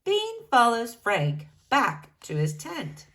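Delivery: ordinary delivery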